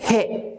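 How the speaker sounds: 해 as a short, falling order; command. Shown as a demonstration. Teacher contrasting order with suggestion, commanding 해. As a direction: give 해 as a one-word command. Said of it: "명령".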